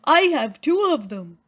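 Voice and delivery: eager moose shouting out in class; yelled. Acted. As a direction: shout it out, thrilled. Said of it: "Morris yelled".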